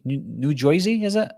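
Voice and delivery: tentative New Jersey twang; New Jersey accent. Acted. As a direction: try out a New Joisey accent, unsure. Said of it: "How do you say it".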